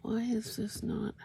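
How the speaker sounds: talking around a pin held in lips; mumbling with a pin between lips; pins in mouth. Real, not acted. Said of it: "If I sound funny, it's because I have a pin in my mouth".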